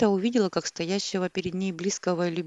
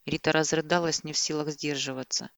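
usual speaking voice

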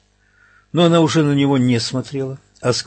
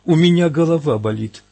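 louder, high-pitched acted outcry; raised voice, pitched up in complaint; crying out; 6 semitones higher, 5 dB louder than usual. A narrator's reading. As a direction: cry it out in protest. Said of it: "закричала она".

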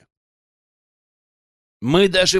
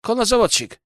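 put-on Irish accent; 9 semitones higher than usual. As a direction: announce it proudly in an Irish accent. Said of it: "пародируя ирландский акцент Оливера".

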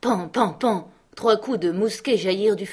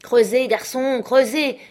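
cold cynicism; 6 semitones higher, 7 dB louder, slower than usual. Acted. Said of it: "avec le plus froid cynisme".

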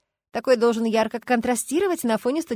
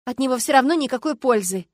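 raised, louder snappy retort; peeved, higher-pitched comeback; snappish; 5 semitones higher, 7 dB louder than usual. A narrator's reading. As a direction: snap back irritably. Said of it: "огрызнулась я".

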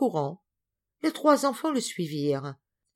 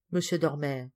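ordinary delivery

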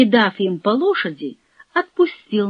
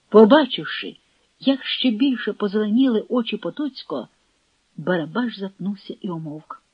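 plain reading voice

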